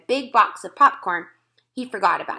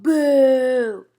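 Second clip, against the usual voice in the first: higher, louder jeering call; loud boo; 5 semitones higher, 8 dB louder than usual. A narrator's reading. Kids in the audience boo the stopped film. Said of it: "Some kids called".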